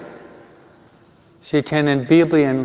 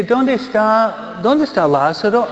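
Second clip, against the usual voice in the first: Jesus calling out; 7 semitones higher than usual. Acted. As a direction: stretch out each dónde, lifting the pitch. Jesus searches for his friend.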